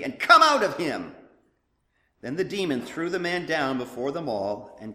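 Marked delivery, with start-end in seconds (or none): 0.0-1.1 loud, firm order; stern command. A narrator's reading. Jesus casting the demon out, stern and loud. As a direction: order the spirit out with full force. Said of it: "Jesus said sternly".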